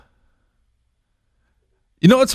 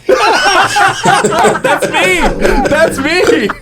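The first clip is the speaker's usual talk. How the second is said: laughing; 13 semitones higher, much slower than usual. Real, not acted. Laughing at the fart clip.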